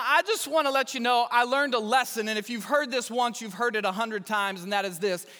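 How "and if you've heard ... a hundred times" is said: ordinary talk, nothing marked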